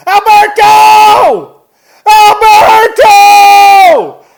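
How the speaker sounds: long, drawn-out high scream of a name; full-force high yell, stretched out; frantic yelling. Retold or imitated. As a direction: imitate a man bellowing wildly for someone lost. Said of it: "he starts yelling".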